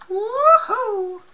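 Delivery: high laughing woohoo; excited whoop. Real, not acted. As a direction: whoop it out as you leap. Jumps off a ledge.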